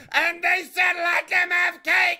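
loud, slow, high declaration; mock royal proclamation. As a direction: proclaim it like a queen. Cake room joke proclamation.

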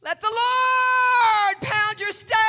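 high, shouted pulpit exhortation; full-force preaching. Real, not acted. Preacher warning young people against the lie, shouted exhortation. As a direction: thunder each line at the congregation.

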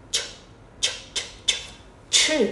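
"ch" thì thầm bật mạnh hơi; "ch" đẩy hết hơi ra, không thanh. Shown as a demonstration. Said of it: "Đọc lại nào". (phụ âm "ch" bật hơi kiểu tiếng Trung)

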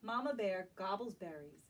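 ordinary delivery

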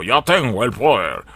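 slow, heroic He-Man voice; mocking the new announcer as He-Man, slow heroic voice; He-Man impression. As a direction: boom it out like He-Man, slowly. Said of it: "Y suena así como".

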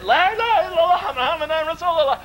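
high, fervent repeated cry; retold group shout. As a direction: echo the leader in one sustained call. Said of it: "Again we shouted it".

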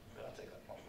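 unvoiced whisper; whispered plea. The sheep to the shepherd, a soft whisper. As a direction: breathe it like a quiet plea.

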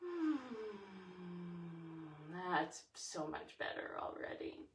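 relieved soft whisper after deep breath; calm breathing. Real, not acted. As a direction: exhale and settle. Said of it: "releasing that with a deep breath".

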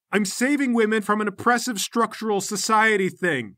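high, loud, heated self-defence; indignant outburst. Acted. Gang leader denying sex offenses, indignant outburst. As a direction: protest the accusation at full tilt.

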